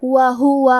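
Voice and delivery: وہو with all three short vowels clear and even; careful وہو, each vowel sounded clearly at equal length; tajweed demo. Shown as a demonstration. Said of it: "تینوں حرکات کو ظاہر کریں گے اور اسے برابر".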